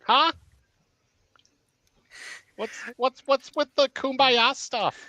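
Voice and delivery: high-pitched put-on character voice; Danny's character voice. Danny questions the group's hugging, high put-on voice. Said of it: "Danny goes".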